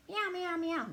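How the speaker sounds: high mock meow; cat meowing. Cat chased by the dog, high meowing. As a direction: mew like a little cat.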